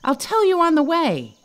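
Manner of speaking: high, urgent, a bit winded; a touch of breathless. Acted. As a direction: rush it out while flying. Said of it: "Tom was almost out of breath".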